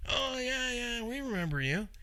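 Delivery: high, soft, far-off put-on clerk voice; friendly lab clerk. Lab receptionist recognising old mechanic on phone, high friendly voice. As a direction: play the cheerful receptionist on the phone.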